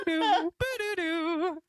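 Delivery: high sung spooky jingle; high mock eerie melody; imitated eerie tune. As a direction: hum a creepy theme tune. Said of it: "imitated eerie music".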